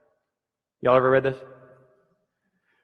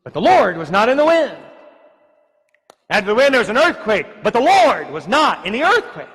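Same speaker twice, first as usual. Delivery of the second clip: loud, high, rhythmic preaching cadence; fiery sermon delivery; preaching at full force; 8 semitones higher, 9 dB louder than usual. Acted. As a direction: hammer each refrain from the pulpit. Preaches the verse refrain.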